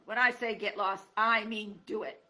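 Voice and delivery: loud angry porcupine; telling the fox off hard; bellow. Acted.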